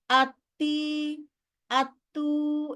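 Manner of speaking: slow drawn-out আত্তি আত্তু with vowel lengths gone wrong; careless emotional reading. Shown as a demonstration. Teacher imitating students who read by emotion, drawn out. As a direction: mimic a careless reader stretching আত্তি. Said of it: "আবেগ দিয়ে পড়লেই হয়ে যায়".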